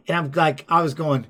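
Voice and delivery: normal talking voice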